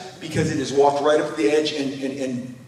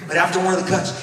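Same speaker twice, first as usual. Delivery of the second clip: ordinary talk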